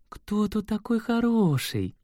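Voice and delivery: high, slow cooing voice; baby talk to pet. A narrator's reading. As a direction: coo at the kitten, trembling with tenderness. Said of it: "дрожащим от умиления голосом спросила".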